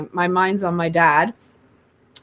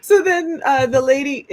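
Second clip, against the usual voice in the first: ordinary delivery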